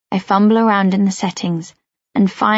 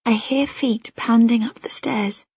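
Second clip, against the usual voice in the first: ordinary delivery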